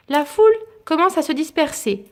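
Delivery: ordinary delivery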